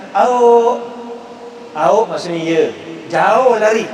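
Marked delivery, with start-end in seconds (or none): 0.1-2.0 Sarawak yes. Shown as a demonstration. Lecturer showing the Sarawak word for yes, Sarawak accent. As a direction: answer like a Sarawakian. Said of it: "slang Sarawak".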